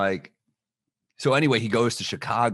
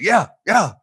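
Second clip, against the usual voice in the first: loud, higher raspy "yeah" in imitation; 10 semitones higher, 5 dB louder than usual. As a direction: rasp it out like a rapper on the phone. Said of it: "It's like that raspy voice".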